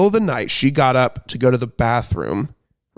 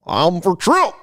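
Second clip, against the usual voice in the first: Goofy; 6 semitones higher, slower than usual. Pretend. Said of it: "Like, maga Goofy".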